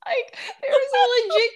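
high, fast words breaking into laughter; can't hold it together; real laughter cracking the voice up high; laughing hard. Real, not acted. Laughing about a show scene.

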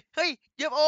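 high mock-startled call of เฮ้ย ยูเอฟโอ; fake alarm. Jiro tricking Moi into looking away, fake startled call. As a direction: point away and cry out in fake surprise.

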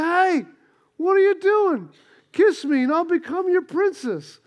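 high, squeaky little frog, a bit pushy. Retold or imitated. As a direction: squeak it up like a tiny frog demanding a kiss. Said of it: "the little frog said".

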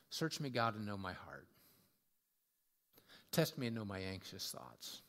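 low, hushed prayer with long pauses. Acted. Preacher offering David's prayer to God, reverent. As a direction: pray it low and unhurried.

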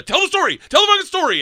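very high, strained yell; Segura heckling. Retold or imitated. Comic friend at the back demands the story, high-pitched yelling. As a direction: imitate a friend hollering from the back, insistent.

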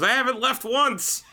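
high, slow, mock innocent; mock grandma. Imitating his grandmother lying about staying in her room, high mock innocence. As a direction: play the innocent old lady. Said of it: "She goes".

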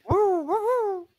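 high woo woo siren; siren imitation. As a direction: voice a siren, laughing.

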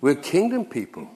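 normal talking voice

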